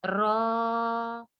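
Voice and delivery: रॉ held long with a heavy r; drawn-out syllable. Shown as a demonstration. Teacher sounds out the letter with its alif, drawn-out syllable. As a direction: stretch the vowel in रॉ evenly. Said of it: "इसको आपने खींचना है".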